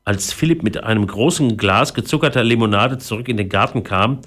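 plain reading voice

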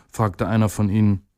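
ordinary delivery, nothing marked

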